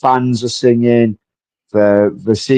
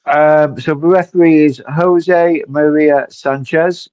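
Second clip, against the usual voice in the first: usual speaking voice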